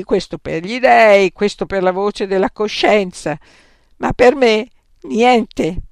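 loud, high, slowing on the last words; bitter outburst. Acted. Santippe complaining that Socrates puts everything before her, loud and bitter. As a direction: pour out the grievance, breaking at the end.

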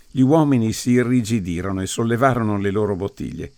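plain reading voice

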